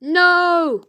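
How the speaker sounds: high loud no; cry out. Acted. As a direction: protest desperately. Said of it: "cried Edmund".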